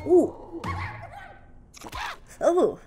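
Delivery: normal talking voice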